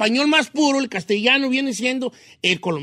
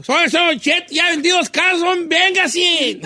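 high, loud hammed-up pitch; loud sales pitch; 8 semitones higher, 3 dB louder, slower than usual. Host mocking his own house-selling video, high and loud. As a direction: holler it like a video advert.

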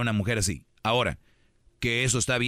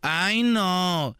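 put-on high whiny dismissive teen; whiny teen brush-off; 7 semitones higher, much slower than usual. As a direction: brush the parent off with a whine. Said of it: "les contestan como si fueran amigos".

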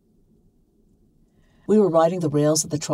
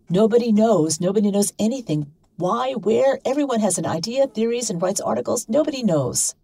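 stern; 6 semitones higher than usual. Acted. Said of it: "Anne said this sternly".

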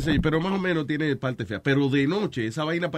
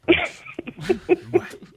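high burst of laughter; 13 semitones higher than usual. Real, not acted. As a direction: break out laughing. Laughs after joke.

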